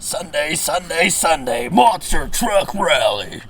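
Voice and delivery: slow booming rally promo; monster truck announcer. Pretend.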